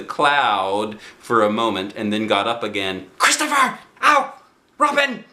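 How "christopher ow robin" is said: high call with a sudden ow; Pooh calling out. Acted. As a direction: yelp as the bee lands. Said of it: "called out the cloud".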